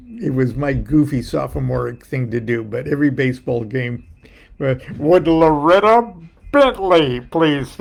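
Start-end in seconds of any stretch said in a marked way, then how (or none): 5.0-7.8 loud PA announcer voice; stadium announcer. Retold or imitated. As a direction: call the name over the loudspeaker.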